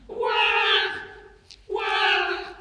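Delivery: put-on loud high baby cry; baby wail. Baby crying in library.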